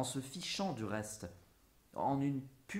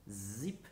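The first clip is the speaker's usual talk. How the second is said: buzzing imitated zip, softer; zip noise; 3 semitones lower, 5 dB quieter than usual. Picasso painting the rising moon, imitated zip. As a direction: make a quick stroke sound with the mouth. Voicing the painter's strokes aloud.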